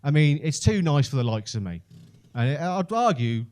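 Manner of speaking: usual speaking voice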